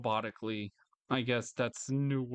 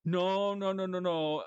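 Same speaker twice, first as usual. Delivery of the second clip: high, put-on clueless voice; mock innocent voice, no, no; mocking believer, high; 6 semitones higher than usual. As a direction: parody a shrugging believer, pitched up. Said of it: "it's like".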